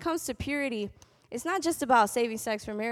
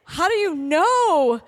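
excited bafflement; 5 semitones higher, 11 dB louder, slower than usual. Retold or imitated. Dating confusion at college.

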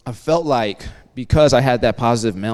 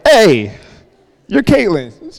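excited recognition; 8 semitones higher, 7 dB louder, much slower than usual. Retold or imitated. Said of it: "I was like".

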